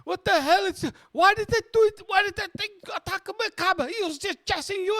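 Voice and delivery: very high, frantic complaining voice; complaining cabbie. Acted. Cabbie upset that his cab was attacked, complaining. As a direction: whine it way up high, hammed up. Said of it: "the cabbie was complaining".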